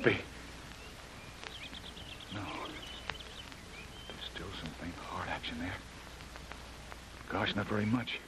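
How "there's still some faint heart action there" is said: hushed, unvoiced whisper. Jim checks Kitty's faint heartbeat, whispering. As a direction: breathe it out in a whisper.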